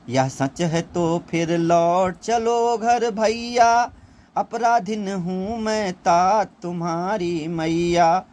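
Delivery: slow, drawn-out recital of the verse; Kaikeyi's plea to Ram recited slowly as verse; poem recitation. Acted. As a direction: read it like a poem, each word stretched.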